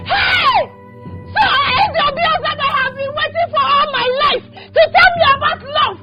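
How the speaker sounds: tearful furious yelling; a jilted woman screaming through sobs at the man who came to talk of love; crying shout. Acted. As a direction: shout it through tears, wronged and bitter. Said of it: "why are you shouting?".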